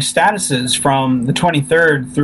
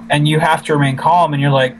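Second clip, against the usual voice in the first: ordinary talk, nothing marked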